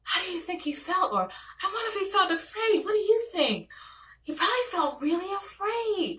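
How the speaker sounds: breathless storybook awe, a touch tearful at the end; animated storytelling. Shown as a demonstration. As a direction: gasp it out like reading to a wide-eyed child. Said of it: "I'm very animated, particularly for my young kids".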